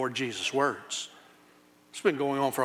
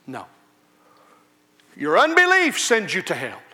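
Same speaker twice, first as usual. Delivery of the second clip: usual speaking voice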